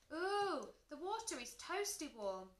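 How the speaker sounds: high "ooh" of delight. A narrator's reading. Children getting into the heated pool, delighted. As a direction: exclaim with happy wonder. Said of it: "The children cheered".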